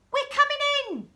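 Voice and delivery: put-on girl doctor storming the house, high call; high triumphant call. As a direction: announce it triumphantly. Sophie announcing loudly.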